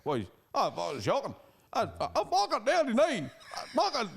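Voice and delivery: high, half-crying drunk rant, hammed up; a hammed-up wail, voice rising; mock tearful rant. Drunk man ranting near tears after a brawl, mock crying. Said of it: "crying a wee bit".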